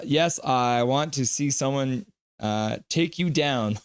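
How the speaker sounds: ordinary delivery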